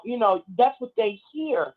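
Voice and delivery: ordinary delivery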